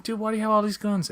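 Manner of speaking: high, fast disbelief; asking a friend in total disbelief; incredulous. Retold or imitated. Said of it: "I'm like".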